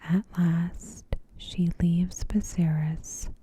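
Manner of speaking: normal talking voice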